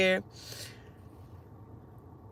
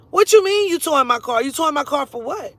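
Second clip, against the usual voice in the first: louder, higher challenge; indignant protest; 3 semitones higher, 4 dB louder than usual. Retold or imitated. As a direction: demand an explanation, voice jumping up. Argues with cop over towing.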